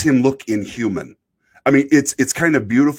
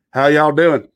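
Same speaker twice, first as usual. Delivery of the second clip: put-on Southern accent; 5 semitones higher, 5 dB louder than usual. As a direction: put on a trucker's Southern twang. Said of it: "brings out the South in any human being".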